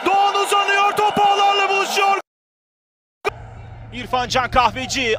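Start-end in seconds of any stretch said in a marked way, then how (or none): none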